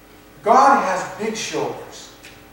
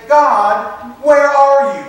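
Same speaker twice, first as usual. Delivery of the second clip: pleading call; 5 semitones higher, 6 dB louder, much slower than usual. Retold or imitated. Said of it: "it's okay to say".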